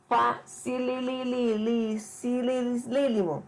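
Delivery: very slow, repeating the LI in "facililililíssimo"; teacher exaggerating how easy it is; playfully drawn out. Real, not acted. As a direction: stretch the word out in a string of li-li-li. Playfully stretched superlative for easy.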